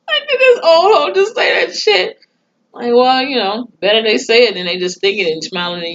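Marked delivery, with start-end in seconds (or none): 0.1-2.2 high, loud, laughing outburst; scandalised disbelief. Real, not acted. Recapper appalled by an old neighbor's remark, shrieky amused disbelief. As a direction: cry it out high, shocked and cracking up.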